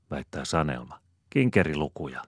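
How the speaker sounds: ordinary delivery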